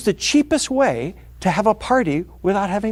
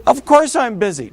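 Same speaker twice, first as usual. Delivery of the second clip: ordinary talk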